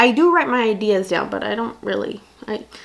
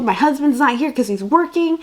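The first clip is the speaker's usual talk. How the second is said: normal talking voice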